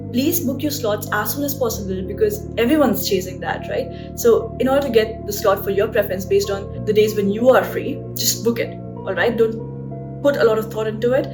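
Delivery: normal talking voice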